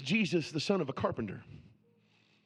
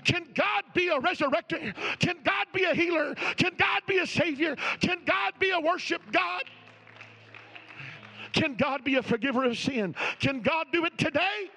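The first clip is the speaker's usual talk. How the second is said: higher, louder, driving preacher cadence; full-force preaching; 7 semitones higher, 4 dB louder than usual. Acted. Preacher pressing the congregation to believe now, at full force. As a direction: preach it hard and lift every question.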